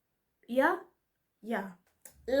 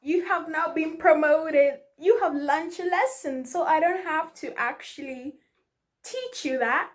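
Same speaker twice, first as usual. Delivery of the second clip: voice of the universe; 5 semitones higher, 5 dB louder than usual. Retold or imitated. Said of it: "the universe is like".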